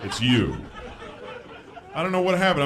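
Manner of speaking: ordinary delivery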